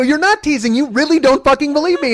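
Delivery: high, loud indignant retort; hammed-up outrage, rising to a yell; heated accusation. Husband accusing teasing wife of disbelief, heated accusation. Retold quarrel escalates.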